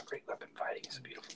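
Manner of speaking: awed whisper. Real, not acted. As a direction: breathe it out in a whisper. Savoring a big weapon hit.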